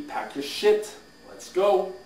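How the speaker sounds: loud order, rising sharply at the end; curt dismissal. Acted. Boss orders a licensed broker out, curt and loud. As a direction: throw him out with a loud command.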